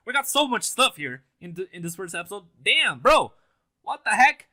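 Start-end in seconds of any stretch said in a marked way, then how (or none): 2.6-4.5 higher, louder amazed exclaim; real excited what the heck; hyped. Real, not acted. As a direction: blown away by the episode. Hyped over premiere.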